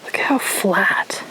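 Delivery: whispering. Real, not acted. Whispering on the quiet trail.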